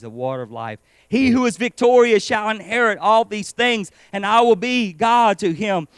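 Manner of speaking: loud, ringing scripture read from the pulpit. Acted. Preacher reading Revelation aloud, loud and ringing. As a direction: proclaim God's words to the congregation.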